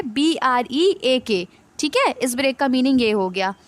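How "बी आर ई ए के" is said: slow letter-by-letter spelling; spelling out slowly. Shown as a demonstration. Teacher spelling a word for students, slow and deliberate. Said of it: "इसका spelling क्या है?".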